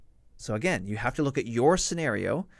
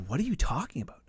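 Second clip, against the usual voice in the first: whispered disbelief; 4 semitones higher, faster than usual. Retold or imitated. Stunned reply to officer.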